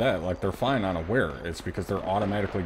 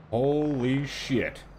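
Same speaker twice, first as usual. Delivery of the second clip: amazed; 6 semitones higher, 3 dB louder, much slower than usual. Real, not acted. Reaction to explosion.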